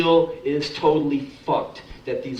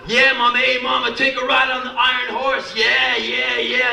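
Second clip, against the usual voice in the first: leering catcall; 7 semitones higher than usual. Retold or imitated. Builder leering at a woman walking past, high mock holler. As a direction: ham up the sleazy yeah yeahs. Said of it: "that one wise ass".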